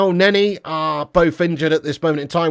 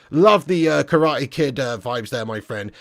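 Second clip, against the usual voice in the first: ordinary delivery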